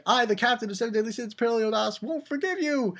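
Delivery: high, rushed proclamation climbing at the end; mock heroic captain. Kid posing as captain of the Sins, mock heroic. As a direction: declare it as a pretend hero.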